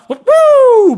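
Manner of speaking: sudden loud high "woo"; high excited whoop. Real, not acted. Telling the cop story, a loud high whoop.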